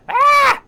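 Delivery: very high, loud startled scream; piercing shriek. Acted. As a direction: shriek out in sudden fright. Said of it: "gives a piercing shriek".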